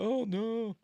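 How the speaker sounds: high mock gasp of dismay; shocked. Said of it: "gets a shocked look on his face".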